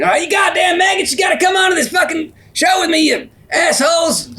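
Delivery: high-pitched barking drill sergeant yell. Retold or imitated. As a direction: bellow at the recruits like a furious sergeant. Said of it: "a drill sergeant at the beginning".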